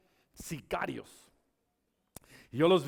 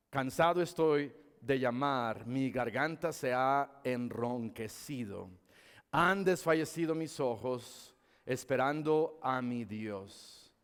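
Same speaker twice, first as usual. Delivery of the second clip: low, measured verse reading; solemn scripture reading; 5 semitones lower, slower than usual. Acted. Preacher reads a weary psalm to congregation, solemn low voice.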